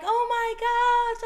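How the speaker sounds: high, squealing oh my gosh; excited squeal. Retold or imitated. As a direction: squeal it like a thrilled best friend. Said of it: "They're like".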